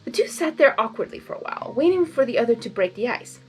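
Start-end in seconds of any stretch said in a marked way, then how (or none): none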